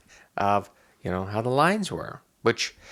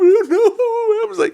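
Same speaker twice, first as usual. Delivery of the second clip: laughing; 8 dB louder, much slower than usual. Real, not acted. Laughs recounting.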